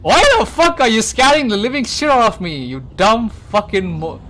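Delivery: high loud yell that fades to plain by the end; angry yelling. Retold or imitated. Man scolding his lover for scaring him, fading angry yell. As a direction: rage at her, then run out of steam. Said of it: "this dude gets so fucking pissed off".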